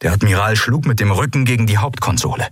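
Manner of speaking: ordinary delivery, nothing marked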